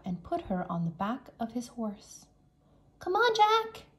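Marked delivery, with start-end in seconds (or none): 3.0-3.8 high, louder call to Jack. A narrator's reading. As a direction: call out across the moat. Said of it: "Annie called".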